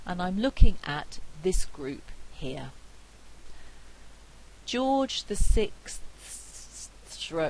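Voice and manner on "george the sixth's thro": effortful, over-careful consonant cluster; tongue-twisting cluster. Shown as a demonstration. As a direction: labour over every consonant in "George the sixth", then break off on "thro-". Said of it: "very difficult to say this".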